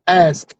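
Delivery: "ask" with s before a separately popped k; correct, over-enunciated ask. Shown as a demonstration. Comic demonstrating correct pronunciation, over-enunciated "ask". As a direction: enunciate every sound of "ask", releasing the final k. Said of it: "the correct pronunciation".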